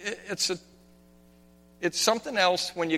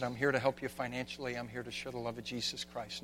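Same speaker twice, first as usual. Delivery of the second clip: tender reassurance; 4 semitones lower, 13 dB quieter than usual. Retold or imitated. Retold tender words to victim.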